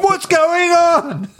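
very high, drawn-out mock wail; hammed-up panic, slow and squealing; mock panicked caller. Acting out alarmed callers to police.

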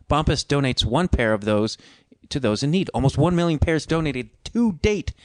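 bright pumped-up sponsor read. Acted. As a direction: sell it like a radio ad. Sponsor read, high energy.

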